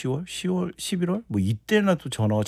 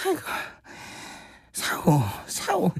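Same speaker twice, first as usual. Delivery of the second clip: sighing groan; 5 semitones higher, 4 dB quieter than usual. Shown as a demonstration. Guest showing how to groan in character, sighing. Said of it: "이렇게 한숨과 함께 섞어줘야죠".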